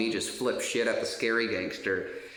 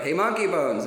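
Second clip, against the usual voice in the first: higher, louder call across the pod; imitated shout; 8 semitones higher, 6 dB louder than usual. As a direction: yell "Hey, Monkey Bones!" like inmates calling out. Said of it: "some people always shout".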